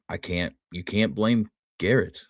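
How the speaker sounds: ordinary delivery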